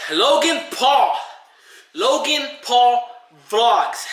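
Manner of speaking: high, slow call-out. Real, not acted. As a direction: call him out, drawn out. Calling out Logan Paul.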